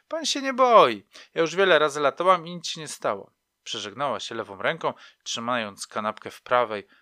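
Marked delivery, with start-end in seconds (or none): 0.1-3.3 put-on reassuring older woman. A narrator's reading. Chatty woman calming a nervous flyer, loud and high. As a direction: comfort him with a rush of words.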